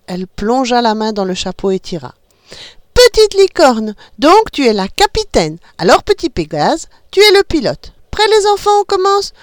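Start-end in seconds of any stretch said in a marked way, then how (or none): 2.9-9.3 high, loud cheerful reveal; excited announcement. A narrator's reading. Mother announcing captain and pilot, excited. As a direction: announce the winners with delight.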